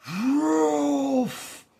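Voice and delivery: high shouted dog bark "Rolf"; loud bark. Acted. Watchdog arriving, loud dog bark.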